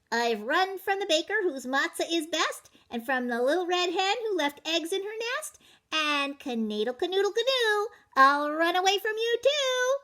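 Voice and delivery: high, bouncy boastful chant; sing-song brag as the runaway cracker; cocky matzah man. Acted. Matzah man taunting the baker in a high boastful sing-song.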